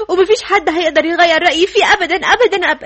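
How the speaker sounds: loud, insistent vow; emphatic, stubborn declaration; adamant. Acted. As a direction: hammer out each repeated word loudly. Vows never to change mind.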